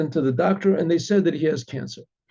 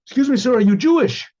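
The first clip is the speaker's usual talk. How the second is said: louder, higher eager street approach; calling out; 7 semitones higher, 5 dB louder than usual. Retold or imitated. Chabad boy calling out to a stranger in the street. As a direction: call out to stop a passerby.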